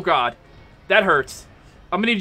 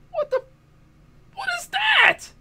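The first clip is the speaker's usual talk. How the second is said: high-pitched, startled squeal of a question; real startled yelp; startled disbelief. Real, not acted. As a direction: react in high-pitched shock at what just appeared. Startled by creature in game.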